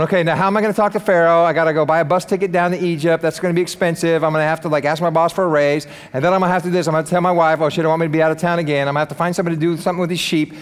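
rapid run-on worrying as Moses; hurried mock-anxious muddle of plans; fretful rambling. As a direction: rattle off excuses without a breath. Moses voiced fretting over God's order.

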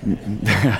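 low, embarrassed laugh at oneself; low chuckle. Real, not acted. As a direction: chuckle low at your own slip. Amused at his own accidental accent.

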